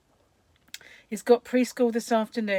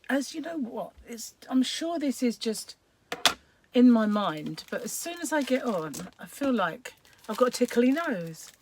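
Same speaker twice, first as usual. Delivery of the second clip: ordinary delivery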